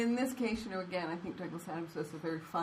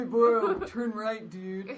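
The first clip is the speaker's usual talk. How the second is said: louder laid-back bro GPS voice; bro accent; 6 dB louder, slower than usual. Shown as a demonstration. Friends asking about a boy accent, bro voice. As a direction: play a dudebro sat-nav with a laugh. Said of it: "What's a boy accent?".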